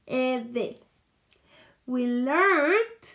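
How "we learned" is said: "We learned" said louder, leaning on "learned"; stress on learned. Shown as a demonstration. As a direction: punch the ED ending of "learned". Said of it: "agregarle a mi verbo la terminación E D".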